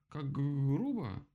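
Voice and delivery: high, slow stuttered protest; indignant. A narrator's reading. Woodpecker protests the rabbit's rudeness, indignant. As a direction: drag out the offended stammer. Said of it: "возмутился он".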